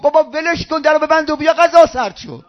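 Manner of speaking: high, loud impatient call from inside the house; wife yelling. Retold or imitated. Wife shouting to her husband at the door, loud and impatient. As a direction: shout it at your husband across the room, annoyed. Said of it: "خانمش داد زد".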